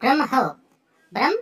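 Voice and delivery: ব্রহ্ম as "bram-ho", the h heard; Sanskrit pronunciation. Shown as a demonstration. Teacher demonstrates for learners, ব্রহ্ম said "bram-ho". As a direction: split ব্রহ্ম into "bram" and a breathed "ho". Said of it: "সংস্কৃত ভাষা উচ্চারণ করলে উচ্চারণ হবে".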